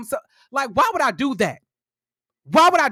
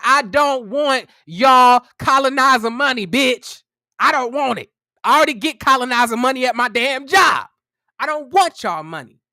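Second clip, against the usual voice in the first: going ham; 6 dB louder than usual. Retold or imitated. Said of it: "I am going ham on these two bitches".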